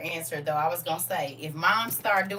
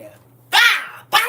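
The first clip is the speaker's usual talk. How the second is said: loud high "bah" crash noise; imitated crash; 13 semitones higher, 18 dB louder, slower than usual. Teller acting the door crashing, loud mock bang.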